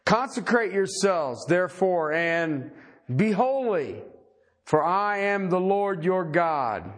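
solemn, measured reading with drawn-out phrases; scripture reading. Acted. Preacher reading God's command to the congregation, solemn scripture reading. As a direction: read out God's words in spaced, weighty phrases.

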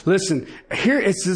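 ordinary talk

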